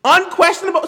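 high, loud echo of the word; unquestionable, said in shock; outraged disbelief. Real, not acted. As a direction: fling the word back, appalled. Repeats the word mockingly.

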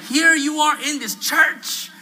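high, loud, drawn-out reproach; fuming. Retold or imitated. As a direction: imitate her scandalised scolding. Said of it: "fuming at me".

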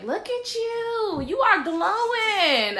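high, drawn-out mock gushing; gushing husband. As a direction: imitate a hyping husband, slow singsong squeal. Retells husband's gushing compliment.

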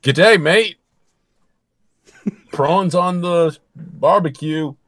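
hammed-up Australian, drawn out; mock Australian accent. Shown as a demonstration. [name]'s acting reel for Australia, mock Australian accent. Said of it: "this is an Australian accent".

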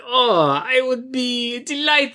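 high, drawn-out fake Italian accent; mock Italian accent. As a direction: imitate a bad movie Italian accent, Super Mario style. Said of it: "accent is Italian. He sounds like a fucking Super Mario brother".

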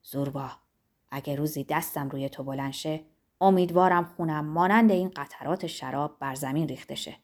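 grave, low and hushed oath; serious. A narrator's reading. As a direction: Manolakas swears a grave oath to Zorba, serious. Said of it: "با لحنی جدی گفت".

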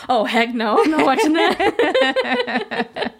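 slow laughing no way; laughing refusal. Real, not acted. Hearing of the remake, a slow laughing refusal.

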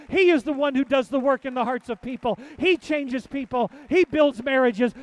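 loud, rising preaching cadence; fervent preaching. Acted. Pastor drives the crowd toward praise, loudly preaching. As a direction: hammer each line home to the crowd.

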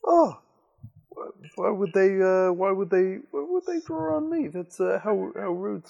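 high, mock-shocked gasp of innocence; fake surprise. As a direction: act wounded and amazed. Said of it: "a kind of feigned surprise".

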